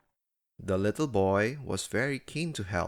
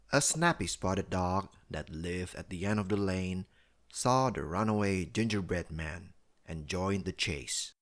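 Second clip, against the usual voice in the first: ordinary delivery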